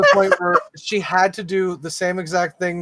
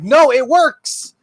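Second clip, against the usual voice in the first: slow, higher and louder, spelling it out; exasperated; 7 semitones higher, 5 dB louder, much slower than usual. Retold or imitated. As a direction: patience running thin, drag it out. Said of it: "verbally getting more and more frustrated".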